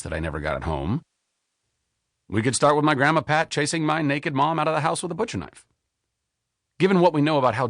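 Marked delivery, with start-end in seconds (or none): none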